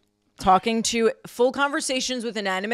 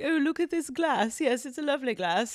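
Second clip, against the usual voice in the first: prim British accent, higher and softer; 6 semitones higher, 4 dB quieter than usual. Shown as a demonstration. Chatting to a lemon glass in a British accent. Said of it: "like, British".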